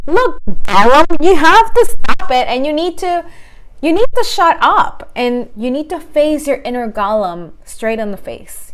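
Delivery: stern scolding. Pretend. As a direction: scold it firmly like a bad pet. Scolding the inner golem.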